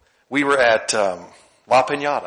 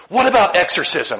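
higher, louder curious asking; earnest young man's question; eager question; 6 semitones higher, 5 dB louder than usual. Retold or imitated. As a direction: ask it like it's an everyday question. Quotes young man's odd question.

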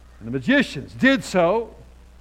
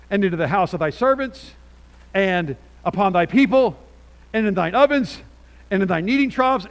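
preaching; 5 semitones higher, 7 dB louder than usual. Acted. Preaching scripture reading at full force.

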